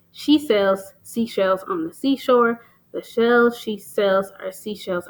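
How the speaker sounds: slow tongue twister, each "She sells seashells" S leaned on; stressed S sounds. Shown as a demonstration. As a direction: lean into every S, slowly. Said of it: "that stress of the S at the beginning".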